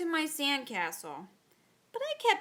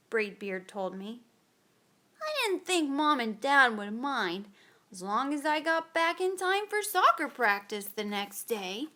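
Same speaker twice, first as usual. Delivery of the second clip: plain reading voice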